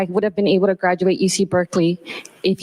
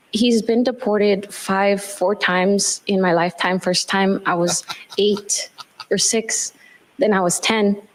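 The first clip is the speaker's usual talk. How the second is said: ordinary talk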